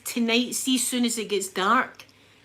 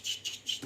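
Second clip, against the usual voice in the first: mist spray sound; 9 dB quieter than usual. Shown as a demonstration. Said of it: "like that".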